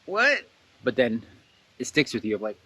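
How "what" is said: surprised. Retold or imitated. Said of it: "it's just like".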